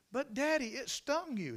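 put-on high child's voice; high-pitched childlike concern; little girl. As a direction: protest to Daddy as a small child, high. Little girl talks to her father.

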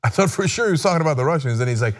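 ordinary talk, nothing marked

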